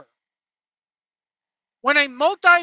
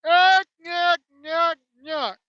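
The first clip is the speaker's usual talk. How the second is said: higher, louder nasal-free yuck chant, hammed-up; mock stooge chant; 4 semitones higher, 4 dB louder, slower than usual. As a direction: bark out the chant like a goofy stooge. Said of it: "What's that? Crickets".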